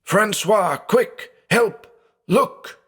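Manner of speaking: high, urgent yell for his brother; roared call. A narrator's reading. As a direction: call Francois in raw panic. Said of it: "threw himself out of bed and roared".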